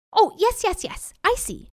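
high, over-eager jackal. A narrator's reading. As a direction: agree far too readily in a high voice. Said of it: "said the jackal eagerly".

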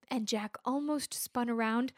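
ordinary delivery

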